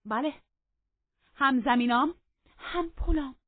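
high, loud comeback, item by item; bold defiance. Acted. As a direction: throw each point back defiantly. Catherine defies the furious master.

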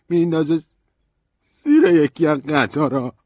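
high, loud, slow halting voice with broken-off words; Stanley breaking down. A narrator's reading. Stanley tells narrator Emily threw herself under a train, breaking down. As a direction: force the words out through grief.

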